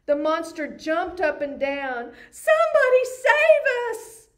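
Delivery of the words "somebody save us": much higher, louder panicked cry; shrieking for help. Acted. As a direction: shriek like you're cornered. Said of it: "shrieked the dogs".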